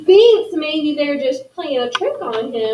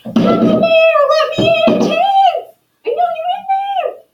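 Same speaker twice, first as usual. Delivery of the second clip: very high, louder angry yell; shouting mayor; 5 dB louder than usual. Acted. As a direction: scream through the door, voice pitched right up, slowed. Mayor yelling at door again.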